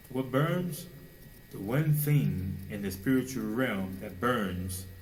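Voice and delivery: ordinary delivery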